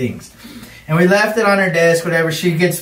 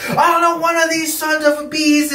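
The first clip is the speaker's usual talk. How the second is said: high mock-furious outburst; exasperated teacher; 12 semitones higher than usual. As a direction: mimic a teacher losing it, pitched way up. Teacher yelling at his colleague.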